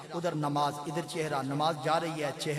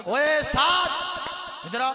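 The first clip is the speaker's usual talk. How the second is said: put-on loud, higher, curt calling of a boy; rough call; 10 semitones higher, 10 dB louder than usual. Shown as a demonstration. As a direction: bark his name across the room. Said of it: "دوسرا طریقہ".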